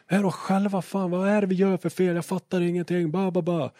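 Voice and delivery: high-pitched mock tirade; retold high, cursing rant; pissed-off driver rant. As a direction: imitate an angry driver grumbling on and on. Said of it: "skitsur direkt".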